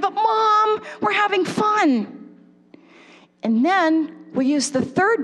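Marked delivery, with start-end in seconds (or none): 0.0-2.4 put-on high, drawn-out kid protest; imitated child objecting. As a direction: plead with mom like a kid having fun. Child protesting to mom in example.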